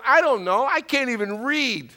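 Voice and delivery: put-on high, exasperated child's comeback; imitated little girl. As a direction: act out an indignant small daughter. Small daughter's indignant comeback.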